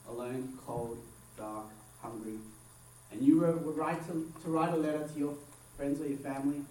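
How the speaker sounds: ordinary talk, nothing marked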